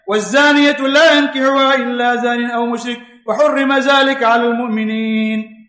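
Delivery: slow, chanted Arabic recitation; تلاوت قرآن; Quranic recitation. Acted. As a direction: recite the verse with tajweed.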